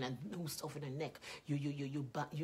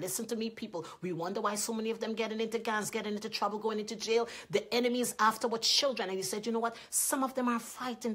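loud, high, driving sermon voice; fiery preaching; 6 semitones higher, 5 dB louder than usual. Acted. Preacher warning the congregation about the enemy, loud preaching. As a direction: preach it hard, pushing each line.

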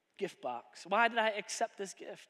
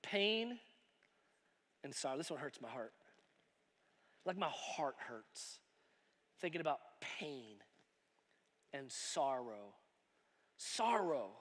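hushed sorrow; 11 semitones lower, 13 dB quieter than usual. Acted. Reading painful gift, heartache.

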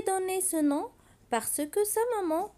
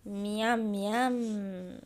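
hungry wolf; 6 semitones lower, 5 dB quieter than usual. Acted. Hungry wolf licks his lips.

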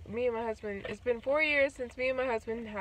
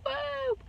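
high-pitched "woo" effect; imitated whoop; 4 dB louder than usual. Shown as a demonstration. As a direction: pop out a high little whoop. Imitates whoop while describing an edit.

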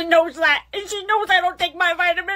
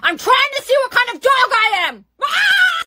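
excited yell ending in a shriek; excited shout; 8 semitones higher, 3 dB louder than usual. Acted. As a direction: shout it out with glee.

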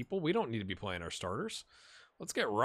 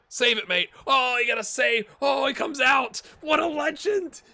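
much higher, much louder cheering; excited shout; 9 dB louder than usual. Real, not acted. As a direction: yell for the save like a fan. Backup keeper makes a save.

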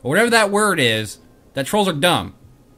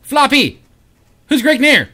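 calling out; 9 semitones higher, 8 dB louder than usual. Real, not acted. Calling out to pet.

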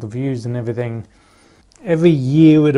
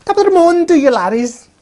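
big, much higher and louder hammed-up Irish accent; mock Irish greeting; 9 dB louder, faster than usual. Vlogger mocks Jacksepticeye's Irish intro, hammed-up Irish accent. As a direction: belt it out like a stage Irishman. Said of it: "I'm not even Irish".

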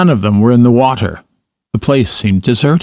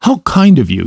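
excited delight; 7 semitones higher than usual. A narrator's reading. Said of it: "he went on, dancing round them".

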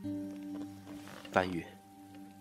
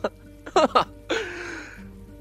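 high, drawn-out villain's cackle; crazed laugh. Acted. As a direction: laugh madly at the question. Captor mocks victim. (疯狂地尖声大笑)

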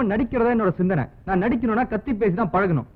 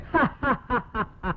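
soft, madman's laugh; crazed laugh; 5 dB quieter than usual. Pretend. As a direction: cackle like a lunatic. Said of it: "பைத்தியக்கார மாதிரி சிரிக்கிறான்". (பைத்தியக்காரச் சிரிப்பு)

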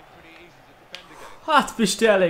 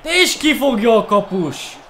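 frustrated shout; 6 semitones higher, 5 dB louder than usual. Real, not acted. Keeper saves the shot.

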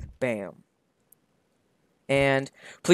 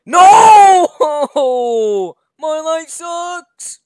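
despairing wail; 13 semitones higher, 11 dB louder, much slower than usual. Acted. Old man wailing in despair.

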